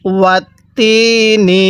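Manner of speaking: slow ওয়াতিনি with a long held তি; stretched vowel. Shown as a demonstration. Teacher has learners stretch along, elongated ওয়াতিনি. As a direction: draw out the i of তি in ওয়াতিনি. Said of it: "আমার সাথে টান দিবেন".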